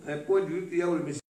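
very fast emphatic repeat. Shown as a demonstration. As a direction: rattle it off fast to drive it home.

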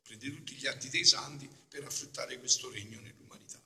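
usual speaking voice

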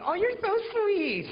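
put-on very high, loud, drawn-out girly squeal; gushing girlfriend. Girlfriend reassured by her boyfriend, mock gushing falsetto. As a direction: gush in a mock falsetto, slowly. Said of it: "And she's like".

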